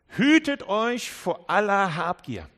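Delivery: loud, slow, weighty warning; Jesus warning them sternly against greed; solemn warning. Acted. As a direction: lean hard into every word. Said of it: "sagt Jesus".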